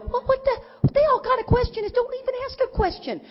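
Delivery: high, exasperated mock voice; mock exasperation. Griping to her husband about the pilot's question, high and exasperated. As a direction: squeak out your disbelief at the pilot.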